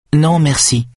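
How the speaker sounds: sharp high no thanks; sudden refusal. Acted. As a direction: blurt it out suddenly. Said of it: "dit-elle soudain".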